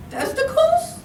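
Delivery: put-on very high squeaky boy's retort; squeaky, proud. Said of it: "in a squeaky yet proud voice".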